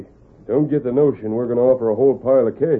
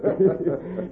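gloating laugh; 6 semitones higher than usual. Acted. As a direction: sly chuckle. Gloating over fooling Andy.

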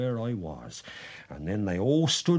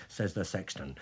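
ordinary delivery, nothing marked

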